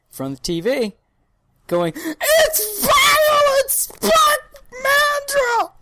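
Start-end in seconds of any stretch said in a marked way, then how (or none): 1.9-5.7 put-on high, slow, shrieking kid yell; excited kid scream. Kid screaming at the TV about the episode. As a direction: scream it like the N64 kid losing it. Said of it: "I turned into the N sixty-four kid".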